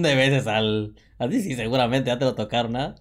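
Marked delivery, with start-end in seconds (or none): none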